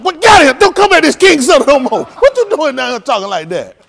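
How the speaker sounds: loud, high, indignant yelling; shouted scolding. Acted. Comic playing someone running off the impersonator, loud scolding. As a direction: chase him off with a shout.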